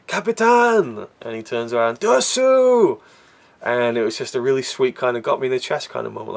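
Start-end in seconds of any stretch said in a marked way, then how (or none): 0.1-1.1 long, high called-out name; shouted call. Retold or imitated. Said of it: "he shouts out". 2.0-3.0 high, drawn-out shout; captain calling back to Dersu across the hill; called-out name. Retold or imitated. Calling to friend far off.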